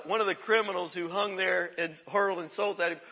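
ordinary talk

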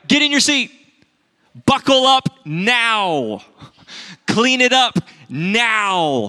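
put-on high, loud, slow barked orders to a child; parent's commands. Father ordering his toddler into her car seat, loud and high. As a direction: bark it like a dad hurrying his kid into the car. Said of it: "You can tell I've really said that".

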